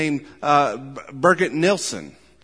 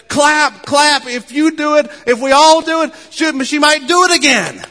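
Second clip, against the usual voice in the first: much higher and louder, urging the crowd to clap; excited cheering urge; 12 semitones higher, 13 dB louder than usual. Retold or imitated. Preacher retelling Rico Tice urging the audience, excited loud cheer. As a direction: egg on the whole opera hall to clap for an encore. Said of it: "I just responded and said".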